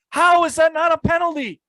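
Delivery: high, loud exasperated yelling; angry shout. Real, not acted. Host ranting at NFL about penalties, furious shouting. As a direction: shout the question at the league.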